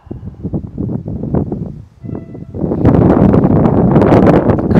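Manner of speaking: long loud sighing exhale. Shown as a demonstration. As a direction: release the breath out loud. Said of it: "let it out".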